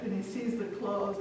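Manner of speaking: ordinary delivery, nothing marked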